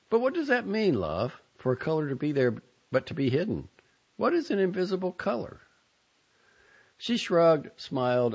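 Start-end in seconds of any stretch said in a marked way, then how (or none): 0.1-5.6 high, wondering question; curious question. A narrator's reading. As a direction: ask with gentle curiosity. Narrator questions the child.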